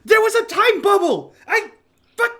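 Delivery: short high yelled curse; screamed curse. Acted. Mitch cursing in the speeding truck, frustrated yell. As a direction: burst out yelling in frustration. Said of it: "as he screams".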